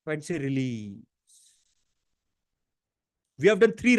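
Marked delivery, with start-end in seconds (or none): 0.1-1.0 much softer, lower sigh of release; hushed exhale on release. Shown as a demonstration. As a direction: breathe the word out gently. Soft release cue.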